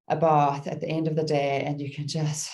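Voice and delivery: usual speaking voice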